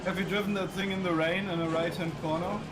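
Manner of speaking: normal talking voice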